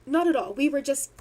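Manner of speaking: normal talking voice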